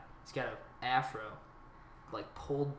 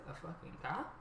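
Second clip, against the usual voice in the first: soft, raised question that fades away; trail-off voice; 4 semitones higher, 10 dB quieter than usual. Retold or imitated. Dealer doubting the silent newcomer, trail-off voice. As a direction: let it trail off like the suspicious dealer. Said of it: "that trail off voice where it lets".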